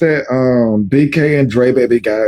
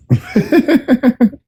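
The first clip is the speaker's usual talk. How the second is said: high, amused giggle in quick bursts; real giggle; 10 semitones higher than usual. Real, not acted. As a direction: giggle rapidly at your own joke. Host amused by caller's voice.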